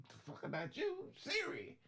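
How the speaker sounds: high mock-rude retort; mock rude reply. Trucker mocking a rude exchange with Siri, high voice. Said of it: "And you go".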